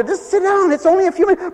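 a touch of a patient mom holding them off; mock parent. Parent answering hungry kids.

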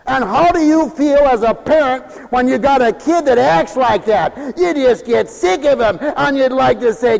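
loud, high preaching at full force; fiery preaching. Acted. Preacher scolding lazy Christians in a loud fiery sermon. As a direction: drive it home to the congregation.

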